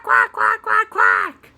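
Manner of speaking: squeaky high duck quacks; playful duck imitation. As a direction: quack like a cartoon duck for kids. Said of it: "It was a quack".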